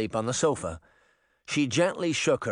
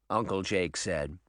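ordinary delivery, nothing marked

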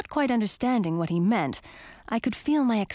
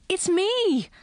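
high, louder shout; frustrated frog shouting up at her aunt; 11 semitones higher, 3 dB louder than usual. A narrator's reading. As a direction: cry out to be noticed. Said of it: "I shouted".

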